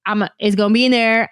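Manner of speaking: ordinary delivery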